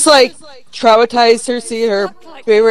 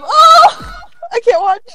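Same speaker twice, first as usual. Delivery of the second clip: high squeal, then high-pitched protest; squeamish horror; 3 dB louder than usual. Real, not acted. Player can't watch the toy being torn, squeamish. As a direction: squeal and recoil from the sight.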